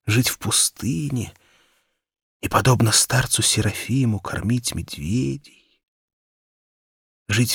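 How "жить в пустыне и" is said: ordinary delivery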